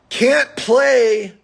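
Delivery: ordinary delivery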